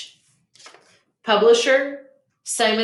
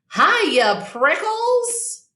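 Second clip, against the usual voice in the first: jackrabbit teasing shout; dash-by shout, much higher; 10 semitones higher, much slower than usual. Acted. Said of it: "she shouted".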